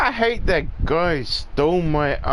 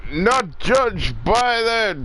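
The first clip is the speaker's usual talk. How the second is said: slow, higher, halting politician voice; Joe Biden; 9 semitones higher, slower than usual. Retold or imitated. Quoting the speech, slipping into a mock Biden. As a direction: drag it out like an old politician. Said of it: "I'm sounding like fucking Joe Biden".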